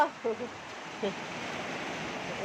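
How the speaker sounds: quiet, trailing chuckle; soft laughing. Real, not acted. Vlogger after hauling things from the rain, soft laughing. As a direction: let a soft laugh die away.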